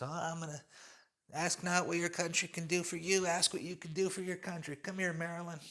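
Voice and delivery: a clumsy Kennedy impression in a Boston accent; bad Kennedy accent. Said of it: "that was a horrible accent".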